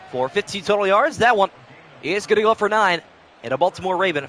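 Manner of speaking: broadcaster building up on third down. Acted. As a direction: sell the big catch like TV. Madden play-by-play call.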